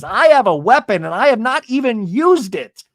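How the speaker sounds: high, emphatic mock defiance; retold indignant retort. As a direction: imitate a friend defending herself. Acted retort with racket.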